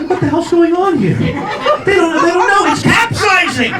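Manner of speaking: high-pitched bewildered alarm; comic alarm, voice way up; mock panic. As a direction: cry out in hammed-up panic. Acted panicking diners on sinking ship.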